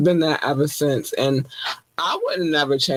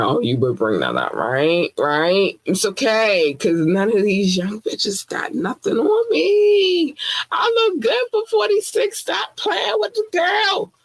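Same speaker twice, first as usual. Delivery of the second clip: sassy; 7 semitones higher than usual. Retold or imitated. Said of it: "And I was like".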